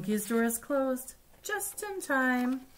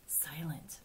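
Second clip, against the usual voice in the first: much softer, lower voice; hushed; 5 semitones lower, 13 dB quieter than usual. A narrator's reading. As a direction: say it very quietly, acting out the word. Picture-book word voiced like its meaning.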